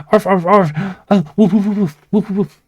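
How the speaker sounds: high excited yapping barks; yappy chihuahua voice. Pretend. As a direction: yap like a small excited dog. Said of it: "I got excited".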